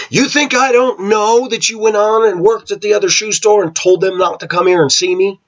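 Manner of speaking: high-pitched retelling of an aunt laying into her sister; angry scolding. Retold or imitated. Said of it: "She laid into her".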